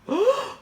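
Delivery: high, loud theatrical gasp; sharp stagey intake of breath; astonished gasp. Acted. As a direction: gasp in amazed surprise. Seamus spies the pizza box.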